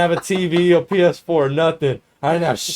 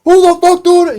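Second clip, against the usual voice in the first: very high, loud outraged shout; heated yell; 12 dB louder than usual. Retold or imitated. Student hit by food behind them, heated yell. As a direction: imitate someone yelling, furious. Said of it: "someone gets heated as fuck".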